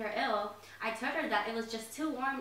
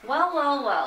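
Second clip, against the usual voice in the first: loud, high-pitched gush; gushing welcome; 4 semitones higher, 11 dB louder, slower than usual. Acted. Mother greeting the gentleman caller with a gush. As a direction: sweep in delighted.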